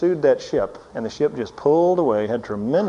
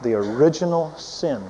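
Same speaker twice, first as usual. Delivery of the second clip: usual speaking voice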